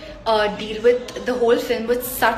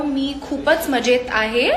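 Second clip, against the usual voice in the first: normal talking voice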